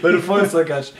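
slow words through laughter; laughing while talking. Real, not acted. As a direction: chuckle through the joke. Joking about burning witch.

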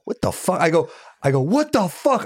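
high, alarmed mock yell; imitating a startled shout at the door. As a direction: yelp it like you just woke to an intruder. Stranger opens hotel door at night.

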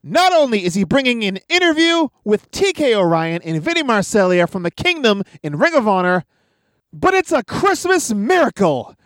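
high, upbeat podcast intro read; announcer voice. Acted. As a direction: sell the show like a host.

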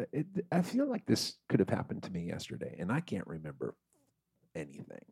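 usual speaking voice

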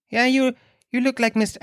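high mocking kid voice; little boy. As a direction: imitate a cheeky child in a high pitch. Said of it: "he comes up to me and he goes".

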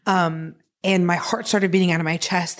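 normal talking voice

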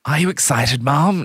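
high, eager host voice; coaxing enthusiasm. Retold or imitated. Host desperately seeking enthusiasm from Mum, mock eager. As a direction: coax some excitement out of them. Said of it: "tries desperately to get some enthusiasm".

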